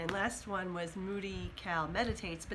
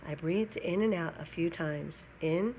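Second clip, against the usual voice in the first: lively, louder storybook reading voice; reading a story to children; 6 dB louder than usual. A narrator's reading. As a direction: read it out to a class of kids, animated. Reads a picture book aloud.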